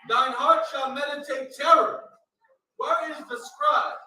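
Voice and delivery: loud, proclaimed Bible verse; forceful scripture reading. Real, not acted. Reader answering the call for Isaiah, loud proclamation. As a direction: declaim it at full volume.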